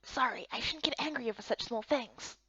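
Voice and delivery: soft whispered apology; sheepish whisper. Acted. Companion whispering an apology after snapping angrily. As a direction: whisper it, embarrassed.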